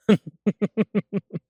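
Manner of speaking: loud, high burst of laughter; amused laugh. Acted. Partner joking about pickup trucks, amused laugh.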